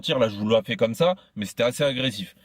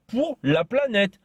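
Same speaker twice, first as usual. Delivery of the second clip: mock slogan; 9 semitones higher, much slower than usual. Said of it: "mocking his slogan".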